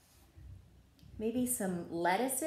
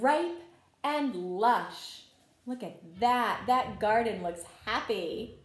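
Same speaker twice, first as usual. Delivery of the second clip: slow, gentle read-aloud for little ones; story narration; 2 semitones higher, 6 dB louder, slower than usual. A narrator's reading. Teacher reading a garden story to children, gentle read-aloud. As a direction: tell the story like a picture book at circle time. Reads picture book to children.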